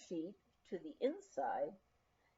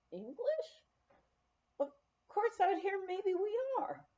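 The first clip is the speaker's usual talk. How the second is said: high, amused and wry; a tickled, much higher mock-surprised answer; amused reply; 10 semitones higher than usual. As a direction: guests teasing the hostess about being English.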